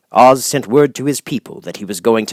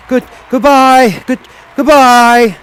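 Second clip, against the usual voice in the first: louder, higher, slow calling goodbye; drawn-out farewell; 14 semitones higher, 9 dB louder, slower than usual. A narrator's reading. The Wizard calls a slow, loud goodbye from the sky. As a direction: wave off from the rising basket.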